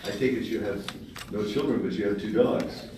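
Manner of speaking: normal talking voice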